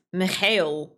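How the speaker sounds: Mikhail said slowly in a Russian accent, with a rolled stress on the last syllable; Slavic accent. Shown as a demonstration. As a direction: draw out Mikhail slowly, like a native Russian speaker. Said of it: "it has to be said with an accent".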